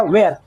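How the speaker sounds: "Wear" as single-syllable VAIR; correct pronunciation. Shown as a demonstration. Teacher repeating the proper word for pupils, "Wear" said as vair. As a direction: model "Wear" with an e, no ee glide. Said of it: "wear होता है".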